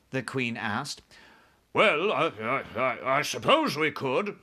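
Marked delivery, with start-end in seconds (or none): 1.7-4.4 halting, reluctant admission; grudgingly. A narrator's reading. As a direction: stammer your way to agreeing. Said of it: "admitted grudgingly".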